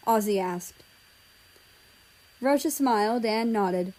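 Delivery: ordinary delivery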